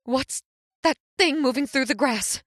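high, alarmed question; sudden fear rising; frightened question. Acted. Mary noticing movement, frightened high question.

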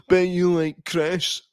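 slow mocking schoolyard taunt; bully voice. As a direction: tease like a kid in the playground. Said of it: "that's how stupid child insults are".

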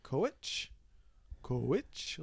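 "coach" as "co-witch", rising at the end; W inside coach. Shown as a demonstration. Demonstrating the added W, Minnesota "coach" as "co-witch". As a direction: stretch "coach" into almost two syllables with a W. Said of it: "Or like a W".

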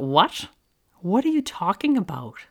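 high-pitched, baffled comeback to a parent; incredulous reply. Retold or imitated. Daughter to mom after an odd question, incredulous. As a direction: act out being thrown by a nosy question.

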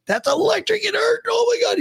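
put-on high, wailing shouted kid voice; a shocked kid wailing in pain; crying kid brother. Brother after grabbing the fence, high wailing shout. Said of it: "He's like".

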